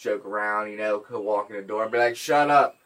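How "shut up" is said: loud, higher joking yell; playful shout on walking in; mock shout. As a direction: holler it as joking banter. Jokey greeting acted out.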